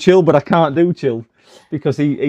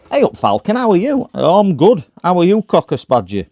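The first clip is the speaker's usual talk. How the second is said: a hammed-up Yorkshire accent, higher and louder; 6 semitones higher, 5 dB louder than usual. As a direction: play a cheery Barnsley lad in broad Yorkshire. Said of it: "He's suddenly got really Barnsley, uh, Yorkshire accent".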